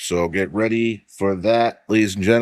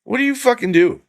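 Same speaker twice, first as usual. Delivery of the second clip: exasperated; 13 semitones higher, faster than usual. Real, not acted. Exasperated rhetorical question about police.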